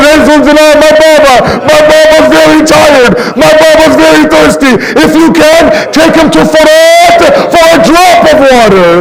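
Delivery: impassioned mourning sermon; emotional religious storytelling; impassioned lament. Real, not acted. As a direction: preach the tragedy as a grieving plea. Mourning sermon recited with full emotion.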